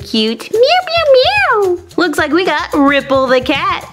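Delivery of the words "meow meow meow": kitten meow. Pretend. The kitty pet greets the host, with high meows. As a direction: mew like a tiny kitty.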